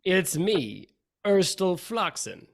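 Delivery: put-on theatrical Russian-accented character. Returning barnacle-toothed foe reveals himself to the party, Russian accent. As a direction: announce yourself grandly in a Russian accent. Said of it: "you hear a familiar Russian accent say".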